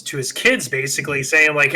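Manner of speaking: ordinary talk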